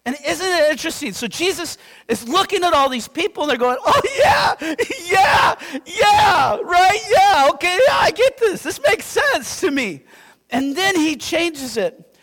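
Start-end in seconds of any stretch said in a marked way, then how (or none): 3.8-10.0 eager agreeing crowd. Retold or imitated. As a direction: ham up the crowd nodding along, much higher.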